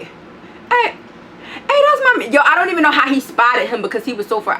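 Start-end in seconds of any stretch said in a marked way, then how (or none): 0.0-2.3 much higher, slow sing-song call; imitating the man. Neighbor calling out to friend Legion, high mock greeting. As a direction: mimic a stranger's cheerful greeting. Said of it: "that shit was like".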